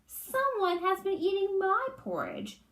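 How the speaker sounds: lightly crying out as Mama Bear. A narrator's reading. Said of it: "cried in her medium-sized voice".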